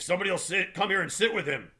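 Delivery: high, loud, commanding snap; authoritative snap. A narrator's reading. Tom ordering bystanders to sit with Wilson, authoritative snap. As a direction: bark the order at the crowd. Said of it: "he snapped authoritatively".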